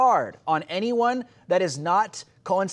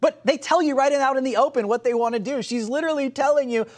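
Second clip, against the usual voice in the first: usual speaking voice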